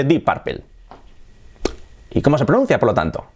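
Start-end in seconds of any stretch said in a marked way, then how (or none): 0.0-0.6 full Spanish vowels in "Purple"; Spanish-style pronunciation. Shown as a demonstration. Teacher showing what everyone here says, hispanicized. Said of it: "Todo el mundo aquí dice".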